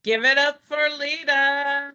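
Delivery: high, loud applause prompt; announcer's call. Real, not acted. Host thanking the poet after the poem, announcer's call. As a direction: belt it out to rouse the room.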